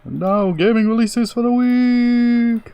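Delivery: slow, high voice sliding down, stretched out; falling voice. Pretend. Co-host teasing the segment title, slow high falling wail. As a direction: sound like you're falling down a well. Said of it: "were you falling down a well".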